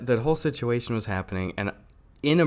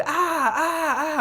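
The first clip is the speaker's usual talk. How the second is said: imitated woman screaming; 13 semitones higher, slower than usual. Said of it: "this woman screaming outside".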